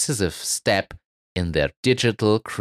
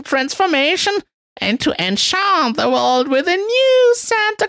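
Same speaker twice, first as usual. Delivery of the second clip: very high, loud shrill executive voice; 4 dB louder than usual. Acted. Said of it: "in a shrill voice".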